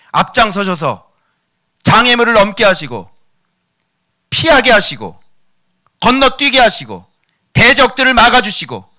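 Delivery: loud, driving sermon delivery; preaching at full force. Acted. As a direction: press every line hard at the congregation. (힘차게 외치는 설교)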